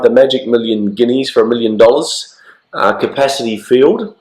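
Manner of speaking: ordinary talk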